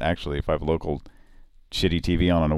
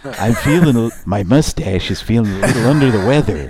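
thirty-four guy voice; 3 semitones higher, 5 dB louder than usual. Retold or imitated. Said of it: "impersonate the thirty-four guy".

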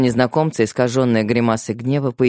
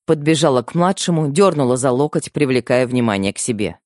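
ordinary delivery, nothing marked

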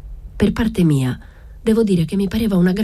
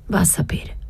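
whisper; 5 semitones higher, 4 dB quieter than usual. A narrator's reading. Assunta whispering near the soldiers.

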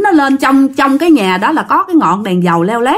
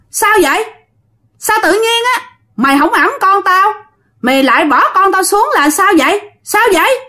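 high, eerie ghost-woman voice, demanding; ghost mother's voice; 8 semitones higher than usual. Retold or imitated. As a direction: ask it like a spooky ghost mother. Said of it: "anh nghe tiếng nói".

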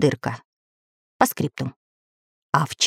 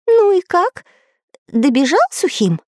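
put-on high eager boy question; high curious squeak; Pencil's voice; 12 semitones higher than usual. A narrator's reading.

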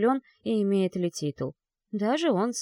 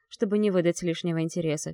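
ordinary delivery

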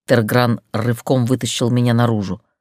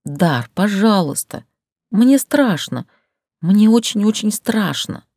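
higher, pleading and frightened; pleading notes; 6 semitones higher, slower than usual. Acted. Heroine begs Dar to test it, pleading higher. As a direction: beg him, voice lifting in fear. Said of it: "добавила в него просящих ноток".

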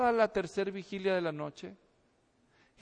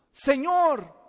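loud cry; 6 semitones higher, 7 dB louder than usual. Retold or imitated. Peter sinking, crying out to the Lord, loud. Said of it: "él gritó".

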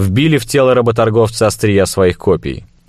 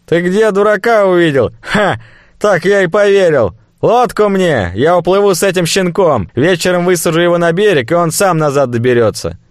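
cornered slaver, scoffing; nervous fat merchant; 10 semitones higher than usual. Acted.

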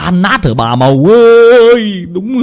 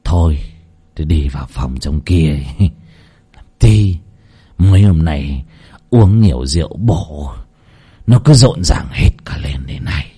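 coaxing; 8 semitones lower than usual. A narrator's reading. Husband comforts his second wife, low and coaxing. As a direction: soothe her gently, unhurried. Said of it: "ông Chí giọng dỗ dành".

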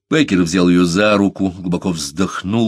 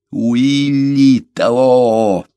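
loud, drawn-out, raised declaration; forceful; 5 semitones higher, 6 dB louder, much slower than usual. A narrator's reading. Беккер declares himself while striking his chest, forceful. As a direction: stretch each word out with force. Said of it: "сказал Беккер с силой".

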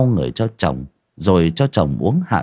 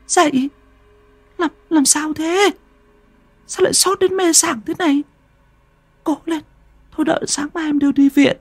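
much higher, anxious wife's voice; wife waking her feverish husband, anxious and wailing. Acted. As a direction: shake him awake, frantic and worried. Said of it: "Thu gào khóc".

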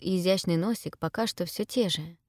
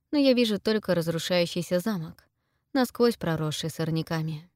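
ordinary delivery, nothing marked

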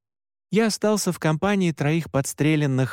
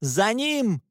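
shouted command; 7 semitones higher than usual. A narrator's reading. Olivet orders the hunters after Alexander, shouted command. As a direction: send them chasing, pitch raised. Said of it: "крикнула Оливет".

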